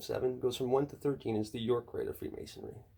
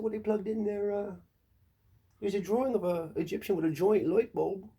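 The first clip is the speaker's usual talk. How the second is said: mock believer; 7 semitones higher, 4 dB louder than usual. Mocked believer answer.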